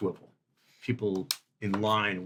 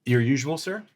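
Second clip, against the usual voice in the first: loud, raised, eager-to-serve question; deferential restaurant host; 5 semitones higher, 6 dB louder than usual. Acted. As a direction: offer a valued guest the usual. Host asks regular guest for order.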